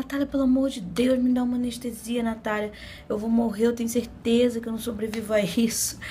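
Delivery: high, dramatic mock pleading; retold frantic begging. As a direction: beg as if about to die, playing up the panic. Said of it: "Desse jeito, gente, passando essa vergonha".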